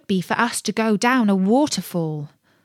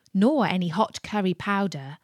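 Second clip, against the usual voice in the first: usual speaking voice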